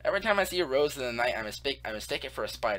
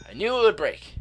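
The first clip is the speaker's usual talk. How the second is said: exasperated; 9 semitones higher, 8 dB louder than usual. Real, not acted. Pickaxe breaks as predicted.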